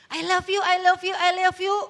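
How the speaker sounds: loud, high mock declarations of love; hollow gushing. Lover gushing with no commitment behind it, loud mocking. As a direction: gush it repeatedly, hammed-up and insistent. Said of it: "Nggak ada komitmennya".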